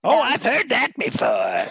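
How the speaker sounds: put-on high old-hag voice; gnarled old hag. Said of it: "now I am a gnarled old hag".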